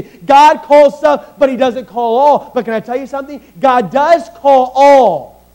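impassioned revival preaching; preaching at full force. Acted. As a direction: thunder it from the pulpit.

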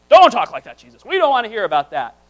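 mock pleading objection with loud bursts; acted protest. Disciples objecting to Jesus foretelling his death, acted loud protest. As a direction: break in loudly as if to hush someone.

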